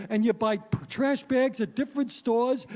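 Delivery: normal talking voice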